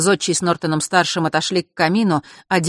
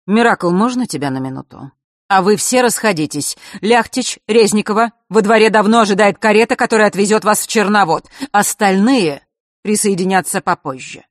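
lightly voiced stern father, higher and louder; a hint of a strict father dismissing the children; stern father giving orders; 5 semitones higher, 6 dB louder than usual. A narrator's reading.

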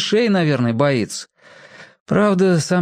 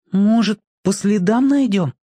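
unsure; 7 semitones higher than usual. Acted. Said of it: "неуверенно предложил Петька".

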